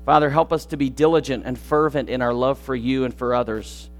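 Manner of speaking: soft, low prayer voice; hushed closing prayer over music. Acted. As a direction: pray it quietly and humbly.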